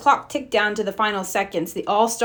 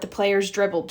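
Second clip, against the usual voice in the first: ordinary delivery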